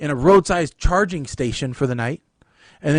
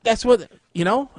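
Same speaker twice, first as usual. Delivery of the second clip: ordinary delivery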